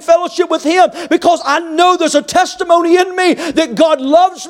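high, impassioned pulpit cry; fervent preaching. Real, not acted. Preaches at full force.

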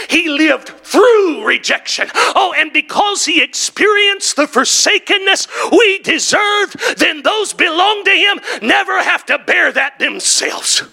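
high, shouted preaching build; fiery sermon climax; full-force preaching. Acted. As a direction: preach it up to a roar.

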